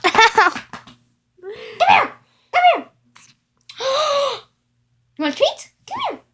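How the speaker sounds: high coaxing call to a puppy; sing-song call to come here; calling a dog. Real, not acted.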